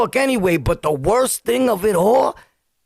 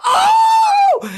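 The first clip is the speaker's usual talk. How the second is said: sudden very high loud yelp of pain; pained shout; 5 dB louder than usual. Retold or imitated. Kid hit by his brother's star, loud high yelp of pain. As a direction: cry out like the star just hit you.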